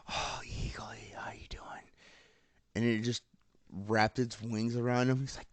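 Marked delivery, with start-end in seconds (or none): 0.1-1.6 hushed, whispered coo to a pet; tender whisper. Retold or imitated. Hero greeting his pet eagle, soft whisper. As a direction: whisper it very softly, imitating a fond owner.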